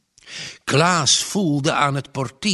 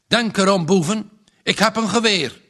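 raised, higher-pitched angry threat; angry warning; 6 semitones higher, 5 dB louder than usual. A narrator's reading. Woken farmer warning supposed crooks at the door, angry shout. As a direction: warn off intruders loudly. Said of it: "riep hij boos".

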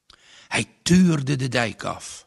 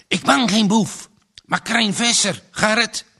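high, raised calling voice; fairly loud call; 7 semitones higher, 5 dB louder than usual. A narrator's reading. Man outside identifying himself to his neighbour, calling out. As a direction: call out to be heard through a door. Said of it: "riep Krijn vrij hard".

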